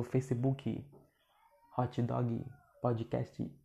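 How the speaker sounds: Facebook said "feicibúki", podcast "pódjikésti"; "Facebook" and "podcast" with a Portuguese final I; Brazilian accented English. Shown as a demonstration. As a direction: add an I to every ending like a learner. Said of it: "quem fala assim".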